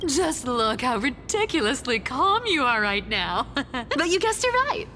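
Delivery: high, laughing tease; amused teasing. Acted. Amused teasing of calm partner.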